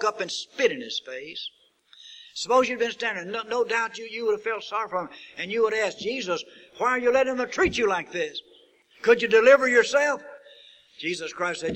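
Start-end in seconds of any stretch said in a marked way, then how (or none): 2.3-10.2 preaching builds to a loud, high appeal; fervent pulpit build; rising preaching. Acted. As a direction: climb into a pleading sermon cry. Preacher rising to full force.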